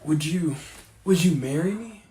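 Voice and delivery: loud eager proposal; excited. Acted. Proposal on stage.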